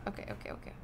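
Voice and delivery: usual speaking voice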